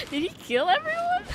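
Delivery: normal talking voice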